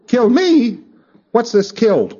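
a thetan baffled to hear he died; baffled disbelief. Retold or imitated. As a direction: sound genuinely puzzled at the news. Thetan baffled at being dead.

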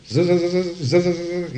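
stuttered machine buzz; imitated buzzing. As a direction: buzz like a moving sine wave. Imitates the oscilloscope wave moving.

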